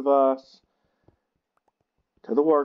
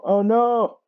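much higher, louder oh no; alarmed cry; 11 semitones higher, 6 dB louder than usual. Real, not acted. Something goes wrong in the game, alarmed yelp.

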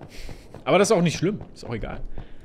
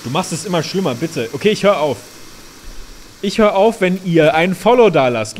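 ordinary talk, nothing marked

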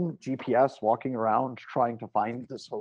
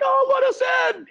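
high, loud call into an intercom; shouted plea; 7 dB louder than usual. Retold or imitated. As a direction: holler to be let in. Calling into gate intercom.

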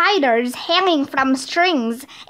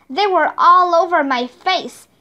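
ordinary delivery, nothing marked

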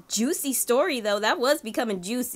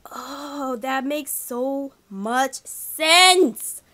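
awed realization; 5 dB louder, much slower than usual. Real, not acted. Realization again.

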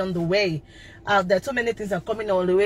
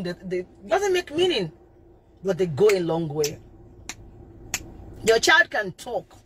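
ordinary talk, nothing marked

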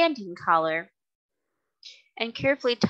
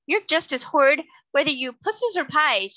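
higher, louder indignant retort; indignant insult; 7 semitones higher, 5 dB louder than usual. A narrator's reading. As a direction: declare it crossly. Angry girl insulting the octopus.

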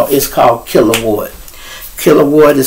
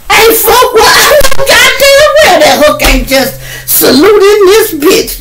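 high, loud laughing cries; losing it laughing mid-story; laughing hard; 4 dB louder than usual. Real, not acted. Laughing at party memory.

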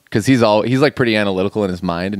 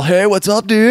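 laid-back surfer, pitched higher; surfer dude voice; 11 semitones higher, 5 dB louder than usual. Retold or imitated. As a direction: greet them like a chill surfer. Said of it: "talks like a surfer".

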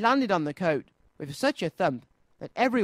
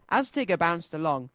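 ordinary delivery

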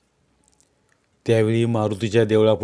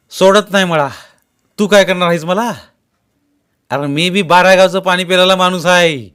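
loud, high, fast menace; furious threat; 8 semitones higher, 8 dB louder than usual. A narrator's reading. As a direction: threaten the bully to his face. Dnyanu threatens the sarpanch. (धमकीचा संतप्त आवाज)

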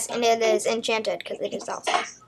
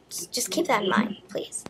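whispered. Real, not acted. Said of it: "my voice is gonna be really stupid".

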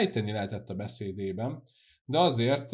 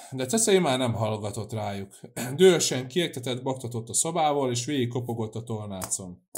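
plain reading voice

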